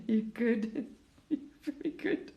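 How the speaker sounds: soft, high chuckling through the words; laughing. Real, not acted. Speaker reassuring the listeners, laughing softly. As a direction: chuckle quietly as you reassure them.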